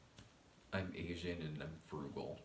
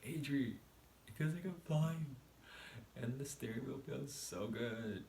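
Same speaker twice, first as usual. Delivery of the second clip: high giddy voice; 7 semitones higher than usual. Retold or imitated. Retelling high, dreamy driving talk.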